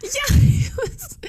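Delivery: high squeal of laughter; breaking into high-pitched laughter; laughing. Real, not acted. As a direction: laugh through the words. Laughing while retelling.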